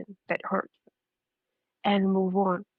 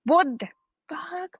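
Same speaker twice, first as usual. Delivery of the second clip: furious reaction; 7 semitones higher than usual. Retold or imitated. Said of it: "I can get furious".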